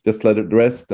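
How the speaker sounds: usual speaking voice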